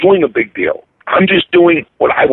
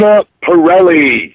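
Italian flourish; 5 semitones higher, 4 dB louder than usual. Shown as a demonstration. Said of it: "You gotta say it".

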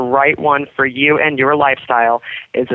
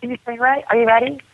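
higher, softer coaxing; coaxing a pet; 7 semitones higher, 4 dB quieter, faster than usual. Real, not acted. Owner coaxes King O to yell hooray, soft high prompting. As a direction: gently egg the bird on.

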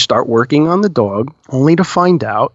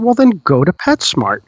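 ordinary talk, nothing marked

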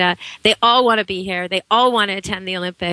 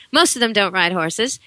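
ordinary delivery

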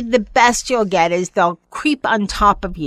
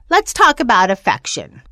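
ordinary delivery

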